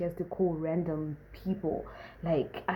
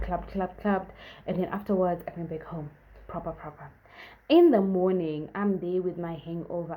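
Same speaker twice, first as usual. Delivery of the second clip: ordinary talk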